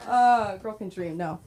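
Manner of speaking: usual speaking voice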